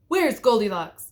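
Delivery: loud stern demand. A narrator's reading. Daddy Bear barging in, demanding loudly. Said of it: "demanded Daddy Bear".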